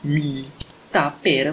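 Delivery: ordinary talk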